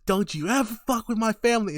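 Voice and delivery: hammed-up confrontation; angry mock threat. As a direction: act out a protective showdown. Stan confronts Bill in retold fight.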